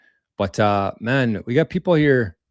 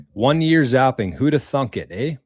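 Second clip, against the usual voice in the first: put-on Canadian accent; 4 semitones higher, 3 dB louder than usual. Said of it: "you sound like a Canadian".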